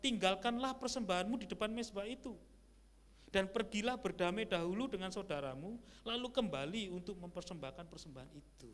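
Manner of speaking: loud, high, ringing Bible reading; preacher reading the verse loudly to the church; scripture reading. Acted. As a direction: proclaim the verse to the congregation. Reads scripture aloud.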